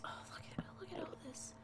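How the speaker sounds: soft, breathy whispered look; whispering. Real, not acted. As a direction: whisper as if sneaking through the cave. Quiet awed look at cave.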